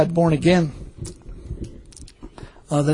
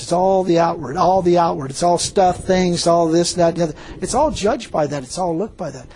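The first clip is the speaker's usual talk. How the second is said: ordinary talk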